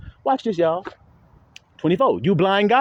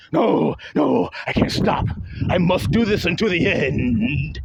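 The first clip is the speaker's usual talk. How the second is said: mocking the obsessed rival; 2 semitones higher, slower than usual.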